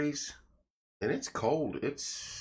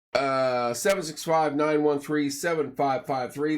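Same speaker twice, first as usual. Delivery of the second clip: upbeat sponsor plug, punchy and bright; radio ad read; 5 semitones higher, 5 dB louder than usual. Acted. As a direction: sell it like a local radio spot. Reads sponsor plugs.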